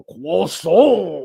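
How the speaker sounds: put-on pretentious French accent; mock French accent. Americans trying to sound worldly, mock French accent. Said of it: "saying it with a French accent".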